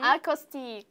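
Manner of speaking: "Acoustic" as "ah-cos-tee", no final k; naive Vietnamese-style misreading. Pretend. Student repeats her beginner's guess, naive misreading. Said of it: "Khánh Vy sẽ phát âm theo kiểu".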